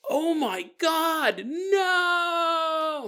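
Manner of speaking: high, slow, panicked cry of no; mock horrified wail from the computer lab; horrified scream. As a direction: shriek it like you just saw something horrible. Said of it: "we hear someone go".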